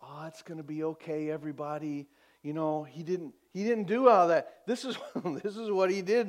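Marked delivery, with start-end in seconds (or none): 0.0-2.1 soft, low, quick mock reassurance; casual soothing. Imitating a weak comforter to mourners, soft and offhand. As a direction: toss off a limp, hushed comfort. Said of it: "He didn't just kinda go".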